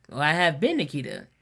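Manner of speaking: ordinary talk, nothing marked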